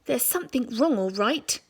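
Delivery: gruff grumbling Mister Twit; lightly voiced grumpy husband. Husband grumbling to his wife, a touch gruff.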